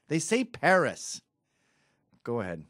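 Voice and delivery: ordinary talk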